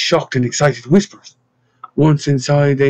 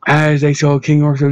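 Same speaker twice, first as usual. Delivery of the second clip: plain reading voice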